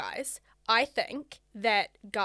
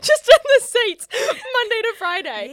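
high, loud, slow, laughing through words; 12 semitones higher, 12 dB louder than usual. Real, not acted. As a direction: laugh out the punchline. Laughing at own joke.

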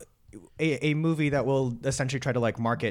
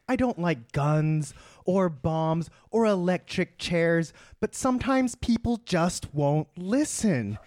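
villain monologue; 3 semitones higher than usual. Acted. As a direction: build the grievance to a grand pleading peak. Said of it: "the villain monologue".

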